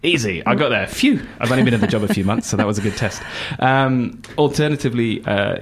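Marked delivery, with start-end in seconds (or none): 0.9-1.3 high sighing phew of relief; relieved phew. Real, not acted. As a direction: let out a real breath of relief, pitched up high. Relief after recalling website.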